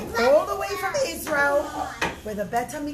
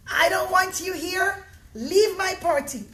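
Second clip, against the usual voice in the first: loud and higher, slowing on the last words; angry shout; 6 semitones higher, 5 dB louder than usual. Acted. Host refusing Bar Kamsa at the party, loud anger. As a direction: shout him out of your party.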